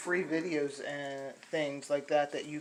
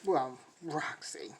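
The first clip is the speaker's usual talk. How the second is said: normal talking voice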